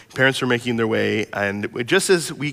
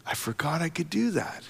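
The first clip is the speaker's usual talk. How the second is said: hushed echo; 3 semitones higher, 8 dB quieter than usual. Shown as a demonstration. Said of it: "repeats child's line".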